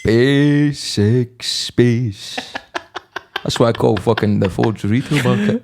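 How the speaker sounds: put-on Edinburgh accent, laughing. Joking to the co-host in a put-on Edinburgh accent. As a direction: drawl it in a broad Edinburgh accent. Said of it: "that Edinburgh accent".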